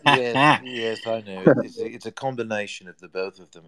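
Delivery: lightly done Aussie accent drifting toward South African; mixed Aussie and South African accent. Shown as a demonstration. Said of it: "you're halfway between Aussie and South African there".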